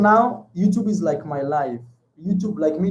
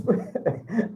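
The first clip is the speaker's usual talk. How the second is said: amused laugh; 4 semitones higher, 7 dB quieter than usual. Real, not acted. Reading escort profile.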